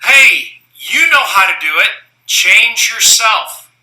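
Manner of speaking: high, loud retold yell, very slow at the end; father yells up to his daughter without leaving his chair; yelling up the stairs. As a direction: shout up to the daughter from downstairs, dragging out the last words. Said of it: "So he yells up to Lauren".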